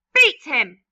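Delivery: loud eager shout; loud cruel aunt; loud cry. Acted. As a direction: yell it out. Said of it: "cried Aunt Sponge".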